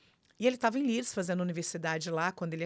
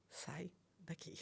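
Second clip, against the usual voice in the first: slow, whispered order, imitated; centred husband; 16 dB quieter, slower than usual. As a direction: whisper it slowly as the composed husband. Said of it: "o Flávio é muito centrado". (sussurro lento e controlado, imitando o marido)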